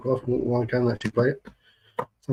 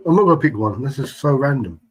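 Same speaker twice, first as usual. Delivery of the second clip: loud protest; 3 semitones higher, 8 dB louder than usual. Real, not acted. Reacting to random chat question.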